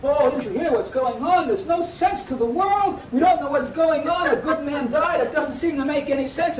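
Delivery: high-pitched, loud mock lament; put-on bewildered griping; mock moping. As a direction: wail like a hammed-up complainer. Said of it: "moping around saying".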